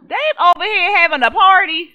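shouted high and loud in outrage; loud outraged disbelief. Pretend. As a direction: yell it across the clinic in disbelief. Outraged at staff partying.

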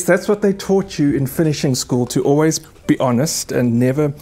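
ordinary delivery

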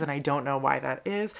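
usual speaking voice

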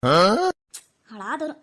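long, loud, stretched-out curse, pitched low; drawn-out curse. Acted. Old woman cursing the ghost, a loud, slow, drawn-out insult.

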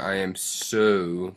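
"so" stretched out long and slow; slow quote with a drawn-out "so"; stress on "so". Shown as a demonstration. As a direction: lean hard on "so" to show the emphasis.

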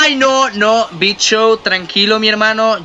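high, frantic begging; pleading for mercy in panic; panicked pleading. Real, not acted. Pleading with Big Show in game.

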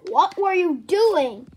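ordinary talk, nothing marked

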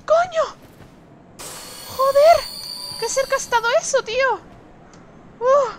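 high, loud startled cries; startled yelps. Real, not acted. Player nearly hit by the boss, shrieking in alarm. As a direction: yelp as the hit barely misses.

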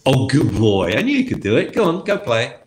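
delighted talking-to-a-dog voice; effusive dog praise. Shown as a demonstration. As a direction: gush at the dog as it obeys. Effusive praise once the dog sits.

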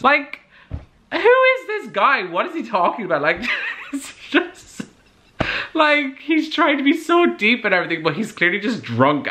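real laughter, voice up high; laughing disbelief. Real, not acted. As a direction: laugh it off in high-pitched amazement. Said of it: "it's just so funny to me".

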